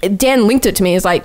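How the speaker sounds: usual speaking voice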